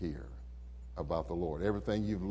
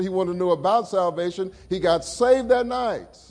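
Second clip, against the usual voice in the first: high, loud preacher's build; fervent sermon climax; preaching at full force; 9 semitones higher, 7 dB louder than usual. Acted. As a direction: lift the congregation with it.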